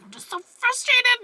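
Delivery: high, drawn-out mock exasperation; retold angry frustration. Recalling being angry and crying, worked-up frustration. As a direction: act out exasperation, slow and way up in pitch. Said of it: "I was so angry".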